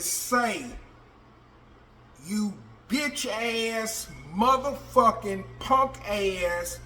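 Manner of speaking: slow, drawn-out insults; calling him out. Retold or imitated. Inmate calling out the snitch, slow and contemptuous. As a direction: spit each insult out slowly.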